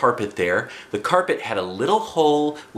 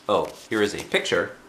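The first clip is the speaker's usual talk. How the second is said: ordinary delivery, nothing marked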